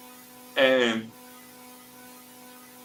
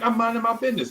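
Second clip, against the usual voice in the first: put-on high-pitched cheeky kid comeback; sassy retort; 9 semitones higher, faster than usual. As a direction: imitate a kid telling a grown man off. Boy sasses the older guy.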